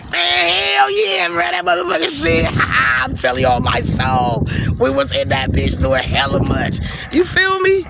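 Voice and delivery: exaggerated friends-only voice after the polite act; hyped homie talk. Shown as a demonstration. Switching back to talk with his people.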